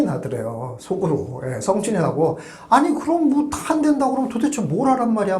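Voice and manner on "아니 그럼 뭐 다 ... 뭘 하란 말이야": high-pitched imitation of a fed-up client; retold exasperation. Client inwardly fuming at the advisor who vetoes everything, retold exasperation. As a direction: act out someone's pent-up annoyance, pitch jumping up. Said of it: "막 이러면서 성질이 나더래요".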